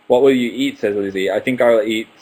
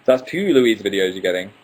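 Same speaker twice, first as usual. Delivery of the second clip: usual speaking voice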